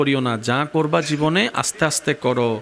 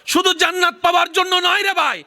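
fiery preaching; 13 semitones higher than usual. Acted. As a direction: preach it at full force, rising in pitch. Preacher's climactic rhetorical questions. (উচ্চস্বরে জোরালো ওয়াজ)

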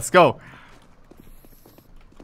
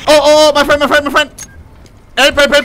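panicked yelling; 9 semitones higher, 8 dB louder than usual. Real, not acted. Panicking under fire in game.

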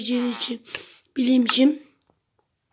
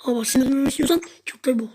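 normal talking voice

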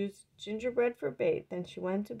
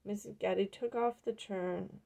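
usual speaking voice

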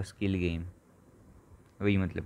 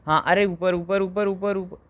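high, loud up up up; high loud cheering of up; urging up; 7 semitones higher, 6 dB louder, slower than usual. Real, not acted. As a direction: cheer the ball upward. Urging ball upward.

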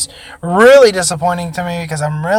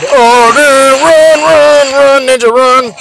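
high, loud, repeated urging; urgent cheering; 7 semitones higher, 6 dB louder, slower than usual. Real, not acted. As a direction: yell at your fighter to flee. Urging dodge in gym battle.

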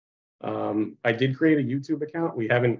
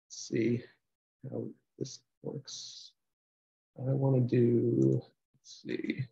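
muttering to self; 5 dB quieter than usual. Real, not acted. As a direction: think aloud under your breath while fiddling with the screen. Talking to self while setting up screen share.

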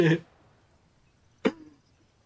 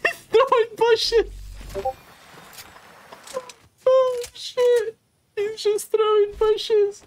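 high, giddy and slowed, barely holding in laughter; giddy disbelief; slower than usual. Real, not acted. Gamer watching his teammate throw bushes, giddy disbelief. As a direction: marvel at the absurd play, voice way up.

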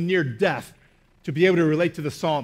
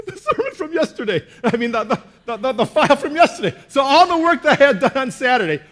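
high, loud laughing disbelief at the loss; 6 semitones higher, 4 dB louder than usual. Real, not acted. Pastor laughing to the congregation about losing his sermon. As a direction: laugh through the bad news, voice up high. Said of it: "it still affects me".

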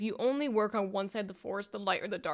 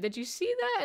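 startled; 11 semitones higher than usual. Retold or imitated. Seknos startled by vision.

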